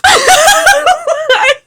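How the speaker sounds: a burst of high laughter. Real, not acted. Laughs after the rant.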